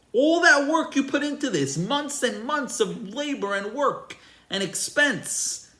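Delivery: high, loud incredulous tirade; screaming outrage. Retold or imitated. Visitor to farmer burning the bread, screaming outrage. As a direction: act out a man yelling in outrage. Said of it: "starts screaming at him".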